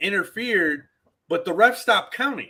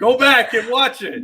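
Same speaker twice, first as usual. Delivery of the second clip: loud, high, playful shout; shouted retort; 6 semitones higher, 8 dB louder than usual. Real, not acted. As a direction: shout it back at the co-host. Shouted retort to teasing co-host.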